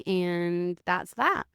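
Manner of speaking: drawn out, slow and final; slow, deliberate closing word; slow final verdict. Real, not acted. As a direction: lay it down slowly like a closing line.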